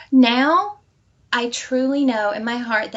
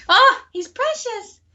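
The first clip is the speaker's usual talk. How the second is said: doting; 11 semitones higher, slower than usual. Real, not acted. Doting on her husband.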